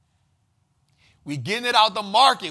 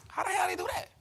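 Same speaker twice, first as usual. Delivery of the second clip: amazed; 12 semitones higher, 6 dB quieter, faster than usual. Real, not acted. Amazed by AI music.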